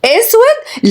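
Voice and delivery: high-pitched disbelieving question; scandalised grandmother; appalled echo. Acted. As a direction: repeat it back in shock, pitch leaping up. Grandmother echoes suggestion, appalled.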